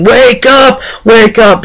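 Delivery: high-pitched mock yell of alarm; imitated yelling. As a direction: imitate a boy yelling to rouse someone. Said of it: "Carl was in the beginning yelling".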